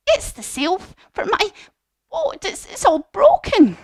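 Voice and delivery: high, fretful goat voice; upset goat. Acted. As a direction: whine it high, like a worried little goat. Goat explains the broken sail.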